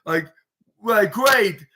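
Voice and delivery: high, loud fake excitement; sarcastic excited scream. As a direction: squeal it with mock joy, dripping sarcasm. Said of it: "Bayley screamed in excitement sarcastically".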